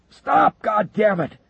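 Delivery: very high, loud furious yell; shouting. Acted. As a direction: scream to stop them. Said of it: "Wilkinson shouted".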